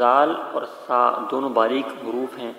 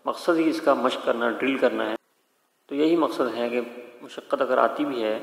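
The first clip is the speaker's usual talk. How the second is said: usual speaking voice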